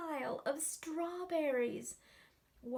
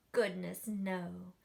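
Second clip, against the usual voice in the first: usual speaking voice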